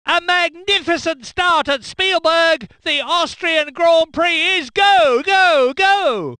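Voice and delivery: excited race call. Acted. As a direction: build to a roar on go, go, go. Race start, commentator erupts.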